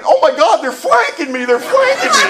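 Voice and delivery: high, loud panicked cry; mock panic. Man circled by charging dogs, mock panic rising. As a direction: climb into a hammed-up shriek. Comic retelling of panicked thoughts.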